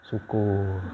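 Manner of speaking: gumaman batin yang rendah dan pelan; suara hati. Acted. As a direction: ucapkan pelan, seperti senang diam-diam dalam hati. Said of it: "Dalam hati kita". (suara batin lirih, nada rendah)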